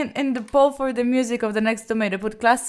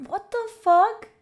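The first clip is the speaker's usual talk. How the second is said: high, loud, drawn-out question; slow, incredulous cry rising at the end; shocked disbelief; 9 semitones higher, 7 dB louder than usual. Real, not acted. Reacts to another huge gift.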